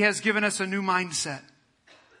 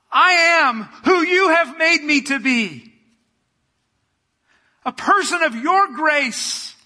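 loud, crying prayer shout, much higher; impassioned yell of devotion to Jesus; 4 semitones higher, 5 dB louder, slower than usual. Real, not acted. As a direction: pour it out at full volume, voice breaking. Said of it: "I don't know why I'm yelling".

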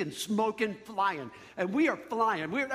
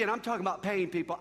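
ordinary talk

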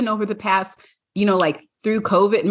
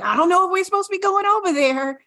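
much higher, quick, worried little voice; nervous dog voice; 11 semitones higher, faster than usual. Pretend. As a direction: voice a wary dog fretting about strangers. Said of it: "And Zoe was like".

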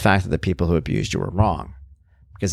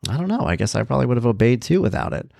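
usual speaking voice

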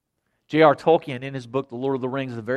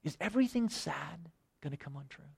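much softer, almost breathed, lightly voiced hobbit; hushed disturbed Sam; 13 dB quieter than usual. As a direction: repeat it near-silent, lost in the question. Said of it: "kinda disturbed a little bit".

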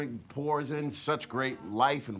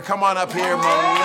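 loud call across the room; 4 semitones higher, 9 dB louder than usual. Real, not acted. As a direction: call her up to the stage. Calling speaker to the stage.

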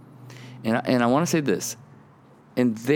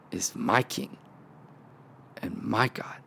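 hushed awe; 3 semitones lower, 8 dB quieter than usual. Acted. Sermon climax, hushed.

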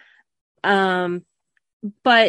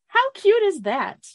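delighted; 12 semitones higher than usual. Real, not acted. Delighted by a child's story.

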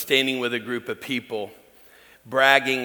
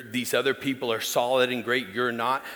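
ordinary talk

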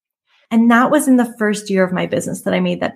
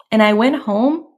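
ordinary talk, nothing marked

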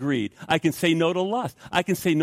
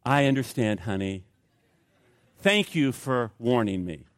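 acted saintly restraint; Spirit-controlled calm; 6 semitones lower than usual. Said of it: "if the Spirit of God is controlling me".

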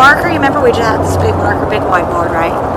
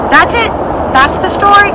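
emphatic; 9 semitones higher than usual. Real, not acted. Coach stresses the only story.

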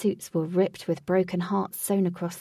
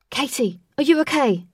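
raised, higher urgent call; worried shout; 5 semitones higher, 7 dB louder than usual. A narrator's reading. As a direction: call out to check on her. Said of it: "shouted Wraith".

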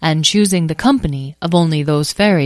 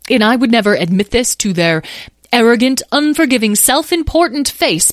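spitting out the rival's faults, worked up; rising indignation; 6 semitones higher, 3 dB louder than usual. Acted. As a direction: build higher and louder on each insult. Wisteria's grudge flares up.

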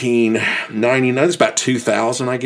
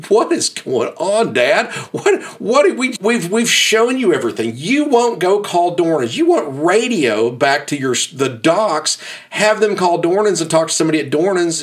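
high, pleading protest to the ranger; exasperated pleading; 7 semitones higher than usual. Retold or imitated. Stan protesting to the young ranger, high and pleading. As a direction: Stan begging the ranger, voice raised in disbelief.

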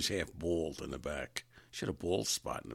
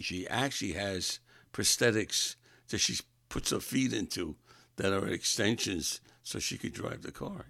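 ordinary talk

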